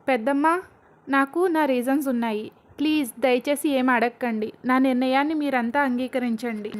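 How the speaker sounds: usual speaking voice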